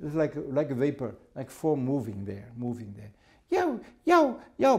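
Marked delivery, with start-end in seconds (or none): 3.5-4.8 high yelped barks; dog barking. Retold or imitated. Storyteller imitating the unseen dog at the platform, barking. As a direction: yelp like an excited small dog.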